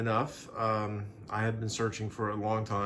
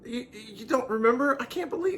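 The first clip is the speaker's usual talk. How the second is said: very high feigned shock; fiancé feigning hurt disbelief; mock hurt disbelief; 13 semitones higher, 3 dB louder than usual. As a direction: act wounded she forgot.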